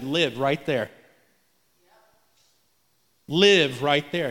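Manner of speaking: low, slow, steady assurance; God's calm voice. Retold or imitated. God telling a humbled believer, low and calm. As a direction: speak it low and settled. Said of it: "And God said".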